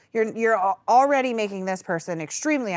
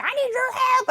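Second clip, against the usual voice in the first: high plea; 3 dB louder than usual. Retold or imitated. Coworker quoted calling for help.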